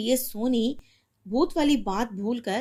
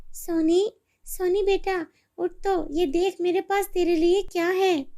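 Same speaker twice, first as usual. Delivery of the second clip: higher, coaxing mother voice; mother waking her daughter, higher and eager; loving mother; 8 semitones higher, 3 dB louder than usual. Acted. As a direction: wake your child with a surprise. Mother wakes daughter with gift.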